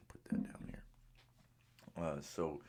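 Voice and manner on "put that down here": whispering. Real, not acted. Aside while moving an object.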